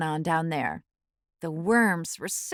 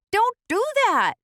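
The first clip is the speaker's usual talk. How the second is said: high, loud warning voice; Oak's warning; 13 semitones higher, 4 dB louder than usual. A narrator's reading. Old oak tree warning the acorn not to jump. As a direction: call out a sharp warning. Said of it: "said Oak".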